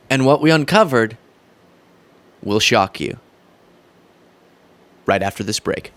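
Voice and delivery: dramatic teaser voice; clickbait video narrator. Pretend. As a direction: build suspense like a clickbait host.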